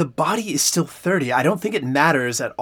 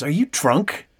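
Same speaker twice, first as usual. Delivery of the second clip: ordinary talk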